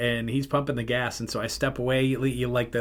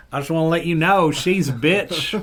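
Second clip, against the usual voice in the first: mock cashier advice; 5 semitones higher, 4 dB louder than usual. Reprises the cashier's voice as joke.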